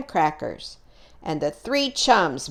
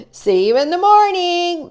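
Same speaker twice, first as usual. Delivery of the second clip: higher, louder friendly call; 12 semitones higher, 6 dB louder than usual. Acted. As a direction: call goodnight across the camp. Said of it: "Maxwell called out to Mama".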